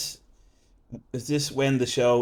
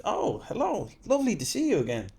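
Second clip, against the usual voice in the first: gushing greeting; 9 semitones higher than usual. Retold or imitated. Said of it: "what do you think would have happened".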